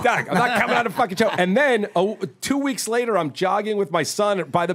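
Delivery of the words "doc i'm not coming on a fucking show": gruff Pesci refusal. Retold or imitated.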